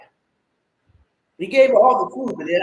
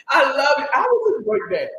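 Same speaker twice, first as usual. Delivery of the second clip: normal talking voice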